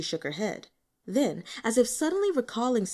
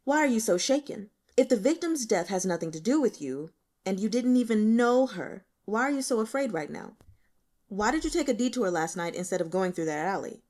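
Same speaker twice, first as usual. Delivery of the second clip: pressing interrogation. Acted. Detective presses frightened witness.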